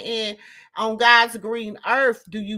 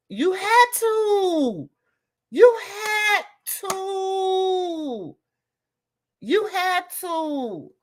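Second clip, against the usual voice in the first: emphatic insistence; 8 semitones higher, much slower than usual. Real, not acted. Repeated insistence, cry on 63.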